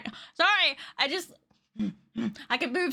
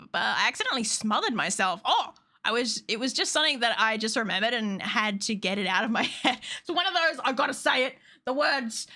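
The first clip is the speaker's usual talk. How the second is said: ordinary talk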